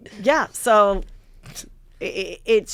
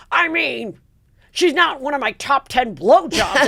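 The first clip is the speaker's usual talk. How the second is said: high, slow, put-on voice for his line; mocking his voice; 6 semitones higher, 4 dB louder, slower than usual. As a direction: play him as a smug guy, drawn out high. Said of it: "He goes".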